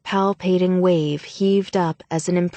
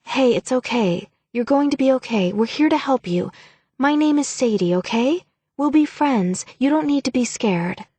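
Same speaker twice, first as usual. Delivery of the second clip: higher, gentle comforting voice; calm a frightened girl; reassuring; 6 semitones higher than usual. Acted. Sadie comforting a frightened newcomer.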